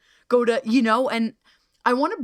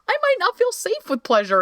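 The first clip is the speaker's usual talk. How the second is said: much higher, small worried voice; a timid, higher pleading voice; voicing a wary person; 14 semitones higher than usual. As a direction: speak as someone scared of being asked too much.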